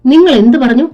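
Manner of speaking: high-pitched, indignant demand; acted outrage with pitch jumping up; outraged challenge. A narrator's reading. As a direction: confront him, stung by the insult. Insulted wife confronts husband.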